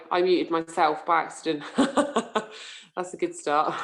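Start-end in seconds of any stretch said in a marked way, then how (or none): 1.7-3.8 laughing at your own slip; amused laughter. Real, not acted. Host laughing to attendees about muting herself, amused. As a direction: chuckle through it, lightly self-mocking.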